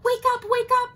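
high, loud urgent call; panting. Acted. Giraffe waking Elephant, high and breathless. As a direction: rouse him in a breathless rush. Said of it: "panted Giraffe".

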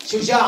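شجاع with damma on the sh, shu-ja'; شجاع said shuja', a short u after sh; شجاع vowelled for dictation. Shown as a demonstration. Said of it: "ضم الشين".

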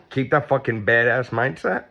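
ordinary delivery

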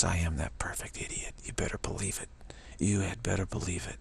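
soft whispered insistence. Real, not acted. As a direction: whisper it close and sure.

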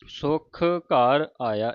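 very slow, drawn-out ਸੁਖ ਘਰ ਆਇਆ; ਹੌਲੀ ਹੌਲੀ ਉਚਾਰਨ; slow recitation. Shown as a demonstration. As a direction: draw the words out slowly. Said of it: "ਇਸ ਤਰ੍ਹਾਂ ਉਚਾਰਨ ਕਰਨਾ ਹੈ".

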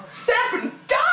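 very high, hammed-up exclamation; mock amazement. Player finding cash in the game, high mock-amazed cry. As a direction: shoot way up in pitch. Exaggerated repeat of cash find.

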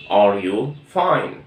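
Are you fine said very slowly; slow classroom question; slow model question. Shown as a demonstration. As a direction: stretch each word like a teacher modelling. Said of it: "السؤال بتاعها حتكون".

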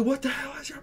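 very high retold outburst; freaking out. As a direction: pitch shoots up, losing it. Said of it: "I'm, like, freaking out at this point".

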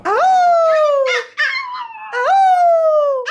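long, high howl; sustained high-pitched "oh" howl; dog howl. Pretend. Howling at the dog Carl.

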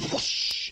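voiceless rushing whoosh noise; imitated whoosh. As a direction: make the sound of something flung past. Imitates thrown flaming burger.

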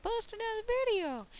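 very high put-on voice; imitated viewer demand. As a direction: squeal the demand in mock impatience. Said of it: "'cause I'm like".

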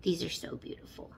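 hushed awed whisper. Real, not acted. Vlogger seeing earrings, hushed awed whisper. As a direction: whisper in wonder.

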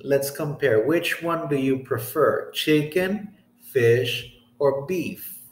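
ordinary talk

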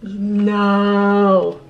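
sharp gasp, then long held no; drawn-out awestruck refusal; stunned disbelief. Real, not acted. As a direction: gasp and drag out the word in amazement. Disbelief on opening the lipsticks.

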